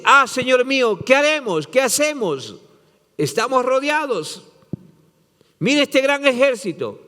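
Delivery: high, panicked pleading to his master; frightened servant. Acted. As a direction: cry out in alarm, pitched well up. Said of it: "se asusta".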